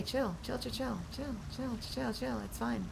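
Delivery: soft, high, gentle repeated chill; soothing hush. Real, not acted. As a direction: hush the chat down like calming a friend. Calming down chat, soft repeats.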